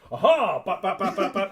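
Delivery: loud "aha" then rapid-fire bap noises; imitated blows. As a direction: voice a flurry of hits. Acts out striking the attackers.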